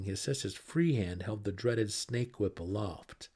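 plain reading voice

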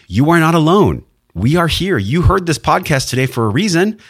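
fervent, high-pitched encouragement; rousing reassurance. Real, not acted. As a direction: lift the voice high to rally the listener. Rallying reassurance to the listeners.

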